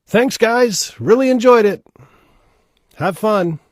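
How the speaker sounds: high, loud troll shout; calling down. Acted. Bridge troll sending travellers off, called out loudly. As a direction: holler it down from a distance. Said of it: "bridge troll calls down to them".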